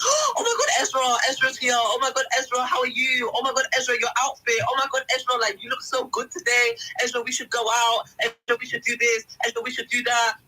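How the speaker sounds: high, excited gushing over Ezra; gushing church members. Retold or imitated. Speaker imitating members fawning over Ezra, high and gushing. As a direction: coo like overeager church friends. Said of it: "they would be like".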